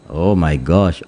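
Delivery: usual speaking voice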